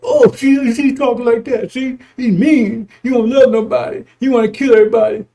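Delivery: high, loud mocking whine of a critic. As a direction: sneer it like someone accusing him. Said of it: "I say things like".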